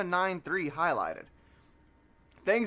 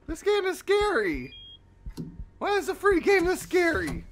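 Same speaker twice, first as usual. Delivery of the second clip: high, tense and nervous; nervous high complaint; scared horror game streamer; 10 semitones higher than usual. Real, not acted.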